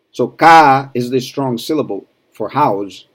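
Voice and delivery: normal talking voice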